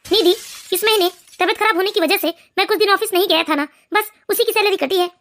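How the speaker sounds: weary husband. Acted. Husband tells his wife he is not hungry, low and weary. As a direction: push the food away, worn down. Husband explains salary cut.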